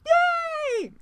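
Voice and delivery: high, drawn-out cheer; very high celebratory hooray. Real, not acted. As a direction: squeal a long yay. Cheering after the show's bumper.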